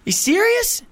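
high-pitched mock disbelief; incredulous. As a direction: squeak it out as if you can't believe it. Retells his disbelief at the news.

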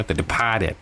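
low, soft Boston film title; quiet, low-pitched touch of Boston; Boston accent. Acted. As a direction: say it lightly in Boston tones. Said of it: "That's from Boston accent".

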